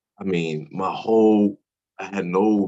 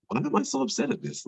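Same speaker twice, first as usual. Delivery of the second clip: baffled inner question; 4 dB quieter than usual. Retold or imitated. Inner voice, baffled at own anger.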